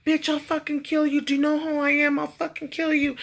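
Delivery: mock threat. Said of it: "was threatening her".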